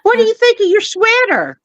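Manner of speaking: high cooing pet voice. Real, not acted. Owner asking dog about its sweater, high cooing.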